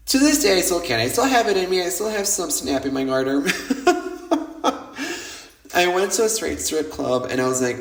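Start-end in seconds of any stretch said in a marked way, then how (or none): 3.5-5.4 amused laugh. Real, not acted. Joking about snap in the garter, high amused laugh. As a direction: run of high chuckles.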